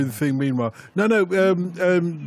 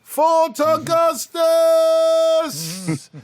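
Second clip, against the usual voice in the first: high, loud, long drawn-out cry of the name; hammed-up announcer shout; dramatic callout; 13 semitones higher, 4 dB louder, much slower than usual. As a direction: bellow it like a grim legend.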